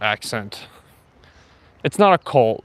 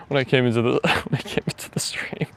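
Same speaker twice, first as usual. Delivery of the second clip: words broken up by real laughter; laughing; faster than usual. Real, not acted. Streamer cracks up at own joke to viewers, laughing. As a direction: try to finish the sentence through a laugh. Breaks up laughing mid-sentence.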